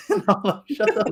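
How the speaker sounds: high-pitched, laughing through the words. Real, not acted. Traveller recalling the GPS voice, laughing hard and high. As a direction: crack up while telling it.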